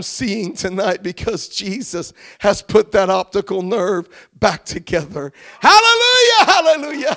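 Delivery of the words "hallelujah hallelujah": shouted praise. Real, not acted. Praise bursts out after testimony.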